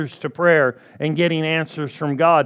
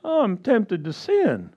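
mock the feeble believer; hammed-up sigh of giving in; mock whiny weakling; 3 semitones higher, 3 dB quieter than usual. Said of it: "I wouldn't go".

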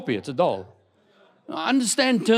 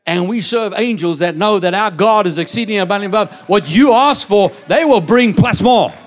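loud, high, repeating "plus more" like a hammer; preaching at full force; 4 semitones higher, 8 dB louder than usual. Acted. Preacher rousing the congregation about ministering angels, full-force shout. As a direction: build the congregation to a shouting climax.

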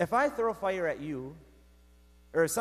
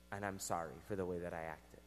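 low, hushed, remorseful retold apology; subdued apology; 10 semitones lower, 10 dB quieter than usual. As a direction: apologise quietly, well down in pitch. Retold apology after grave news.

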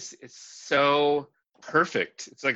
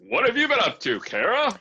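exaggerated radio voice; 11 semitones higher, 4 dB louder than usual. Continues the mock radio banter.

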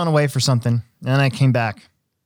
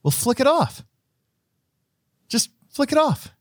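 dude's advice; 13 semitones higher than usual. Retold or imitated.

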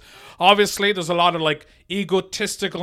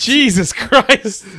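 slow, drawn-out exasperation; exasperated; 4 semitones higher, 5 dB louder, much slower than usual. Real, not acted. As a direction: groan at how long it ran. Shocked at the runtime.

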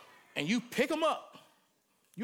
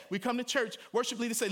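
ordinary delivery

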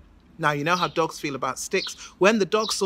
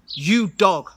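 louder commanding address; stern king; 7 dB louder than usual. Acted. King of Mali confronting the dog, loud stern. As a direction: bark the charge at the dog.

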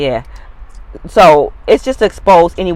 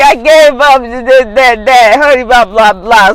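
mocking imitation; 5 semitones higher, 7 dB louder, slower than usual. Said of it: "You're like".

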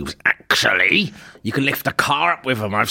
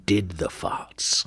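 hushed whisper; 5 semitones lower, 8 dB quieter, slower than usual. Acted. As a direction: drop to a sly whisper. Hushed dramatic aside.

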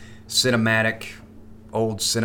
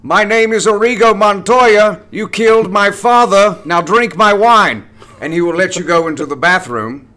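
high, loud theatrical proclamation; Patrick Stewart; 10 semitones higher, 11 dB louder than usual. Acted. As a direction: declaim it slowly and grandly, pitched up. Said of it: "random Patrick Stewart impression".

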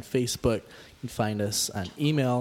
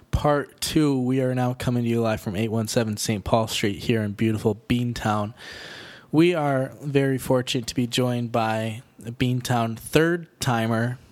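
louder show host announcing; announcer; 5 dB louder than usual. Acted. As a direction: welcome listeners back like a radio host. Announces part two of show.

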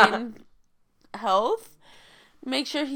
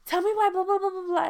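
excited; 8 semitones higher, 4 dB louder than usual. Retold or imitated. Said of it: "I'll be like".

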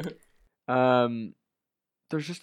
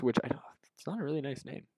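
hushed whispered aside; 13 dB quieter, faster than usual. Real, not acted. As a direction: drop to a whisper. Aside about the Maggots name.

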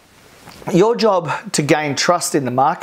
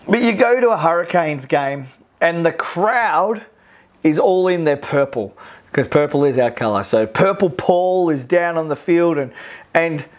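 normal talking voice

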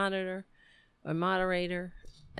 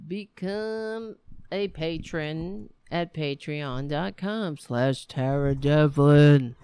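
slow, loud shouted plug, swelling at the end; shouting into the mic; 2 semitones lower, 9 dB louder, slower than usual. Real, not acted. Host shouting his Patreon plug at listeners, slow loud shout. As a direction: bellow the web address at the listeners. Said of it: "I'm shouting it into the mic".

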